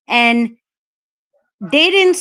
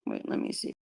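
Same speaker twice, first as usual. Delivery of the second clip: whispered aside; 6 semitones lower, 17 dB quieter than usual. Real, not acted. Muttering while checking the chat.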